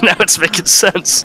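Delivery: laughing. Real, not acted. Laughs while speaking.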